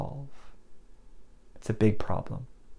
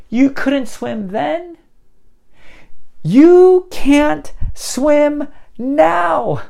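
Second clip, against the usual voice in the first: high, slow, exasperated disbelief; incredulous scolding; 8 semitones higher, much slower than usual. Acted. Paul rebukes the foolish Galatians, incredulous scolding.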